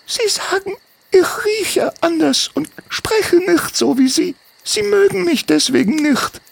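put-on very high child's voice, downcast; a hurt kid telling her story; sad little girl. Sventlani explains sadly.

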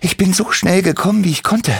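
leicht außer Atem, hoch gesprochen; schnaufend. A narrator's reading. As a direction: kurz angehaucht vom schnellen Laufen. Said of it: "schnaufte Timmy". (ein Hauch Schnaufen nach dem Rennen)

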